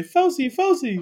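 high, squeaky little creature chirping its name; cute high-pitched Pokémon voice; Pokémon name call. Pretend. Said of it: "You have to say".

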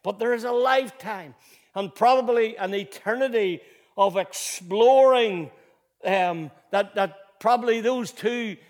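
impassioned pulpit voice; full-force preaching. Acted. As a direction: preach it with building fervour. Preaching on eternity.

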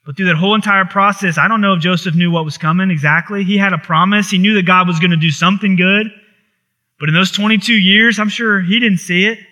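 ordinary delivery

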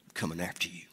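low, soft, menacing warning, half in jest; quiet threat. Shown as a demonstration. Mother warns people who mess with her children, quiet menace. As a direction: let the threat simmer under the breath. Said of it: "Can you hear it in my voice?".

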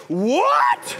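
high, loud mock outrage with a laugh; mock outraged Christians crying what; shocked what. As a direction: squawk it like scandalized churchgoers. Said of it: "And Christians were like".

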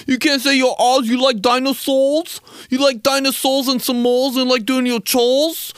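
high, loud taunt with dropped R's; mocking baby talk. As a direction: mimic a kid who can't say R's, teasing. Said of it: "Normal people would be like".

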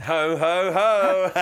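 loud, high, drawn-out ho-ho-ho; booming Santa ho-ho. Acted. Guessing what Santa says, booming mock Santa laugh. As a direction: belt out Santa's laugh in jest.

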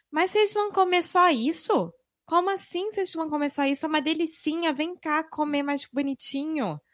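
high, sweet mineira aunt; coaxing high sing-song hostess; mineiro accent. Retold or imitated. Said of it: "a Silvinha falando com a gente".

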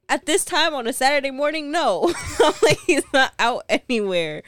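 high, loud, half-laughing disbelief; retold incredulous denial. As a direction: shoot the idea down, loud and high. Retold incredulous answer to mom.

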